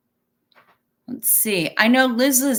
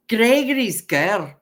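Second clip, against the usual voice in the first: louder, put-on Scottish accent as a kid; mock Scottish accent; 5 dB louder than usual. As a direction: say the film title in a Scottish accent. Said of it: "and I was Scottish".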